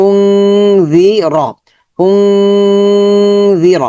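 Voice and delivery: উংজি with long nasal ng held; ghunnah demo. Shown as a demonstration. Teacher showing ghunnah to students, drawn out. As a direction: hum the ng of উংজি slowly. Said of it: "এখানে গুণনা হয়েছে".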